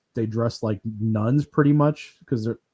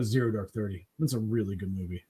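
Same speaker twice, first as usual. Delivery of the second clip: Zero Dark Thirty said lower and softer, dad-like; imitating dad; 5 semitones lower, 8 dB quieter than usual. Son imitating his serious dad recommending a film, low soft voice. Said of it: "He goes".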